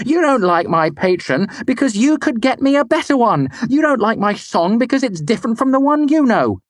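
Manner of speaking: very high, loud anger; furious. A narrator's reading. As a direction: accuse him outright. Denna furious.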